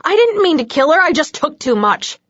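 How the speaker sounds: panicked vampire shouting his excuse; desperate yell. A narrator's reading. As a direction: yell it out, begging to be believed. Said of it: "he yelled".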